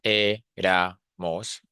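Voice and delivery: éramos drawn out slowly in three separate syllables. Shown as a demonstration. Teacher showing that éramos has three syllables, syllable by syllable. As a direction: break éramos into é, ra, mos, slowly. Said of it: "because it has three syllables".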